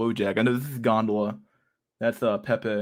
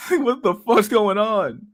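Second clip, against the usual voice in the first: exasperated; 10 semitones higher, 5 dB louder, much slower than usual. Real, not acted. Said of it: "exasperated rant".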